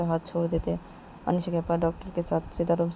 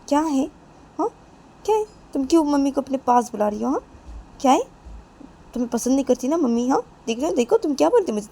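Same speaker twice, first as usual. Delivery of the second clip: raised voice, high and loud; confrontational challenge; 7 semitones higher, 7 dB louder, faster than usual. Acted. Nisha challenges Manisha for calling mother over, confrontational. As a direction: needle with insistent questions.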